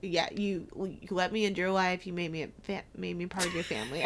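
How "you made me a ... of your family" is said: ordinary talk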